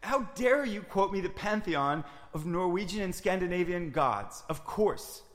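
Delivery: loud offended outburst; angry. Acted. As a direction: blast it, deeply insulted.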